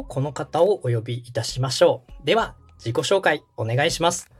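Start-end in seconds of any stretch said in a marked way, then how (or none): none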